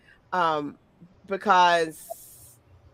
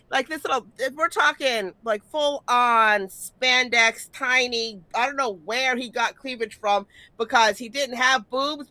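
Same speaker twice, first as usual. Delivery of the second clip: usual speaking voice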